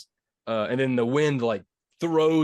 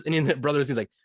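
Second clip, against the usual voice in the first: normal talking voice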